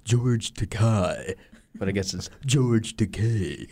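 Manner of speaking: George Takei impression. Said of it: "my only skill in life".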